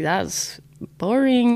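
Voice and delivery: slow, drawn-out bored verdict; bored dismissal. Retold or imitated. After kissing boys, unimpressed, slow and bored. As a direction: drag it out like it's a chore. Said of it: "I was just like".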